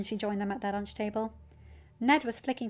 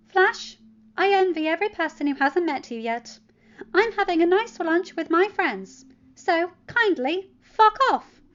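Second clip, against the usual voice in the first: angry retort; 9 semitones higher, 10 dB louder than usual. Acted. Teen telling the bully to leave, angry. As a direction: tell him off, done with it. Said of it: "Peter demanded".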